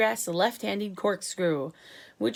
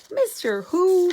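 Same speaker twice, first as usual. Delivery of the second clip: much higher, louder, slow scream; 10 semitones higher, 10 dB louder, much slower than usual. A narrator's reading. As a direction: shriek it in disbelief. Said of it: "screamed the president".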